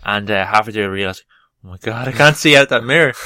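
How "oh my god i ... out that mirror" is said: panicked. Retold or imitated. Retold panic while driving.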